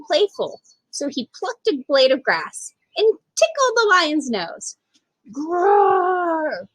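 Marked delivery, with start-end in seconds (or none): 5.3-6.7 a big throaty roar, far from the narrator's voice; lion roar. A narrator's reading. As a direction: roar like a huge woken lion. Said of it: "roared the lion".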